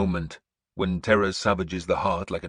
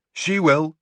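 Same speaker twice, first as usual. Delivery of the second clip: a called reply, higher and louder; 8 semitones higher, 7 dB louder than usual. A narrator's reading. Son calling to the warrior beside his father, called reply. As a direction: answer across the line. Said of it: "my son called".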